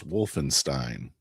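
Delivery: Wolfenstein said the American way; American pronunciation. Shown as a demonstration. Co-host correcting a podcaster, American pronunciation of Wolfenstein. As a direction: demonstrate an Americanized Wolfenstein, with an English W. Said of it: "the way Americans say it is".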